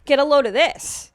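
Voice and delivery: mimicking older men's attention-grabbing openers; imitating older men. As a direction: play a man commanding the room, a bit hammed-up. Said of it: "they'll say something like".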